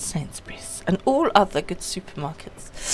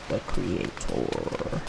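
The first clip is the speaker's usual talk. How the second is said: much lower, slow and ominous; creepy low repeat; 7 dB quieter, much slower than usual. Pretend. Streamer echoing a game line, slow creepy low voice. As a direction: drop into a creepy deep echo. Mock-ominous echo of word.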